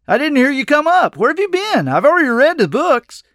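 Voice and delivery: high, eager rush of excitement; bubbling over to see a friend; enthusiasm. A narrator's reading. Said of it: "He chortled at my enthusiasm".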